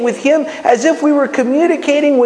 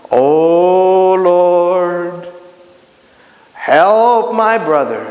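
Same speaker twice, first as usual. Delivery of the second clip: long drawn-out, lower, mock solemn prayer; showy pray-er; 4 semitones lower, much slower than usual. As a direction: stretch each word like a pompous intercessor. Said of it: "those people that, that, that, that starts to pray".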